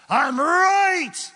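shouted high and loud; defiant shout. Acted. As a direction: insist hard. Preacher mocks the arguer.

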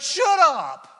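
high, loud mock shout; yelling shut up at the movie spoiler; annoyed shout. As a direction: snap at the know-it-all friend. Annoyed viewer yells at spoiler.

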